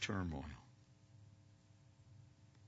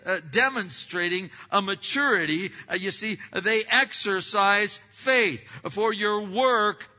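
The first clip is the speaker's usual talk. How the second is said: loud, higher, emphatic pulpit delivery with long stressed words; preaching at full force; 11 semitones higher, 14 dB louder than usual. Acted. Pastor exhorting his church on the work of faith, full-voiced preaching. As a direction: drive each point home to the congregation.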